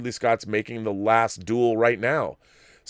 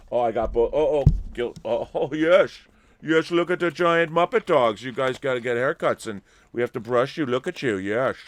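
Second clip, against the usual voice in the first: higher, gushing voice for the dogs; talking to pets, pitched up and delighted; doggy talk; 4 semitones higher than usual. Real, not acted. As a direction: coo over the big dogs with a laugh. Fussing over the two dogs.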